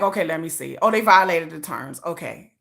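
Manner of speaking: flat low checking-it-off voice; YouTube worker voice. Retold or imitated. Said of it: "They like".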